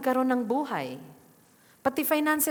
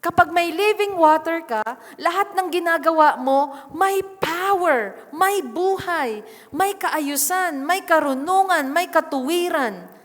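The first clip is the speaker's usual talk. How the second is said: fervent preaching; 6 semitones higher, 6 dB louder than usual. Acted. Preacher builds to full force.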